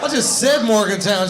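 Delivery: ordinary talk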